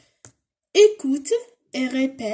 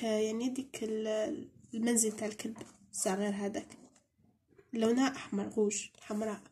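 usual speaking voice

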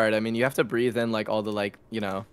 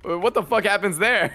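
baffled; 8 semitones higher than usual. Retold or imitated. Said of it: "It's just like".

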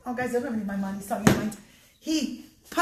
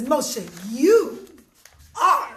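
louder, drawn-out verdict with pauses; suspenseful judge; 6 dB louder, much slower than usual. Acted. Judge about to give Moshe his verdict, suspenseful. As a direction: hold them in suspense.

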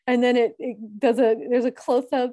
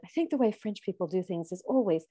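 lightly imitated British accent, lower; 3 semitones lower, 5 dB quieter than usual. Retelling the French suitor's line about spice, mild British accent. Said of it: "in his British accent".

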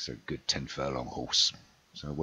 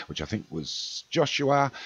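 normal talking voice

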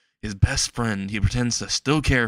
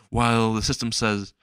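ordinary delivery, nothing marked

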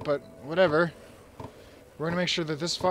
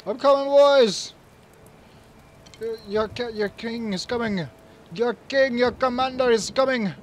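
higher, louder commander's cry; heroic rallying shout; 6 semitones higher, 7 dB louder than usual. Pretend. The player as king riding to help his soldiers, shouting heroically. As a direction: announce the king's arrival to the troops. King rides to the other side.

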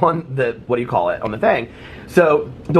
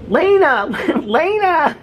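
much higher, louder call of a name, laughing; imitated shout; 3 dB louder, much slower than usual. Girl calling her friend in the pool, high loud shout. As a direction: yell the name across the pool like the girl did. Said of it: "shouting at her, trying to get her attention".

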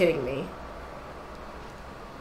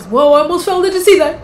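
loud, high, startled exclaim; 7 semitones higher, 11 dB louder, faster than usual. Real, not acted. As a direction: react to nearly falling. Nearly falls in game.

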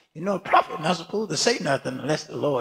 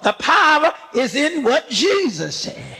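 preaching shout; 6 semitones higher, 4 dB louder than usual. Real, not acted. Preaching climax with music.

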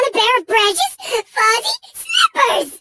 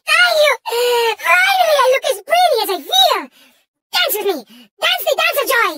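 overjoyed. Acted. Engine thanking Crash for the pretty costume, elated. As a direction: gush in hammed-up delight.

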